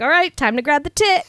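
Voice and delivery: high, loud caricature of eagerness; [name], mock eager. Mocking the boyfriend's move at the drive-in, loud eager blurt. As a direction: blurt it like an overexcited horny teen.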